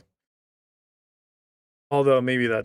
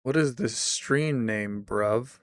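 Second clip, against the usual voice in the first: usual speaking voice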